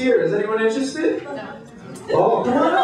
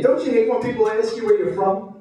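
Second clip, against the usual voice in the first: normal talking voice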